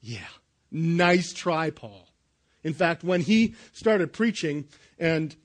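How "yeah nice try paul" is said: dry, much softer "yeah, right"; eye-rolling sarcasm. Retold or imitated. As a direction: scoff at the claim quietly, trailing off on "Paul". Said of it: "people are rolling their eyes going".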